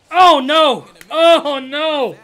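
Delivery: loud, high repeated groan of dismay; frustrated yelling; dismayed shout. Real, not acted. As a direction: cry out at the miss. Strikes out in the game.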